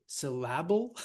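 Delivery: syl-LAB-le, middle syllable punched with a full A; syllable said as sih-LAB-ul; exaggerated second-syllable stress. Shown as a demonstration. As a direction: wrongly hit the LAB in syllable, questioning. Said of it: "try the different options and just exaggerate them".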